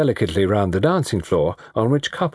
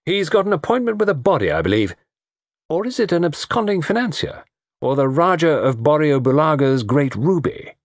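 high, mocking speculation; teasing young man; 8 semitones higher than usual. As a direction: melodramatic banter, pitched well up.

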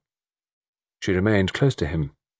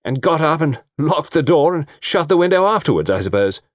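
louder, sarcastic jab; cutting; 8 semitones higher, 4 dB louder than usual. A narrator's reading. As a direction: slice at him with sarcasm. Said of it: "said Diana cuttingly".

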